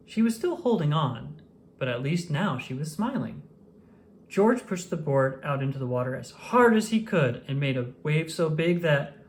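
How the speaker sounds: ordinary delivery, nothing marked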